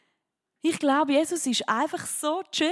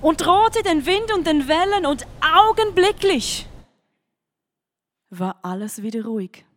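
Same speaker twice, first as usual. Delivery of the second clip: dramatic Bible reading; 3 semitones higher, 5 dB louder, slower than usual. Acted. Preacher reading the storm story to the youth, dramatic and louder. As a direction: storm story read with rising force, slow at first.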